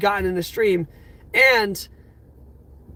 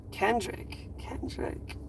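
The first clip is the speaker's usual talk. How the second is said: real whisper, fading away softer; stunned whisper; 12 dB quieter, much slower than usual. Real, not acted. Streamer to generous friend after a big gift, stunned whisper. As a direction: breathe the name out, overwhelmed.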